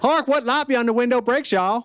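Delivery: mock-grand Bard line with a Southern twang; Southern accent Shakespeare. Comic doing Romeo in a Southern accent. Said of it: "without a Southern accent".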